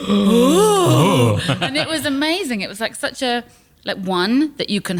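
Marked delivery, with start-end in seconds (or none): 0.1-1.4 loud, breathy ooh of wonder; delighted gasping ooh. Retold or imitated. Improv class gasping at a guest's bold move, loud ooh. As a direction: gasp with delight at the surprise. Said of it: "And we all went".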